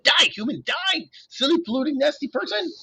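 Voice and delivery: a manic Grover shooting at imaginary humans; game-crazed satyr. A narrator's reading. Grover ranting at the human targets in his hunting game, high and manic.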